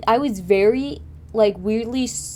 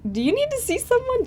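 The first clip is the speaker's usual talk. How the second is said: much higher, a mock worried dad voice; concerned dad; 14 semitones higher than usual. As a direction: pitch it up like a fussing parent. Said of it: "Dad was like".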